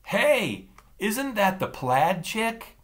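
loud, high call across the room; too loud call. Acted. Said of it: "a too loud voice behind me announced".

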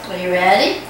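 ordinary talk, nothing marked

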